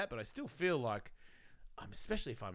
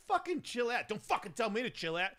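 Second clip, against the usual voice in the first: high-pitched acted argument; heated retort; 10 semitones higher than usual. Retelling the quarrel with police, heated. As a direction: imitate a testy back-and-forth.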